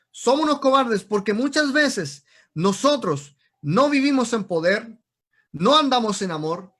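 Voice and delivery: loud, forceful exhortation; urgent, ringing pulpit call; fiery preaching. Real, not acted. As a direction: preach it at full force to the congregation. Preaches and reads scripture forcefully.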